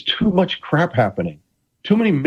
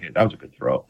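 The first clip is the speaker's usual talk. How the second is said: referee voice, lower; 12 semitones lower, 4 dB quieter, faster than usual. Retold or imitated. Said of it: "Jerry's like".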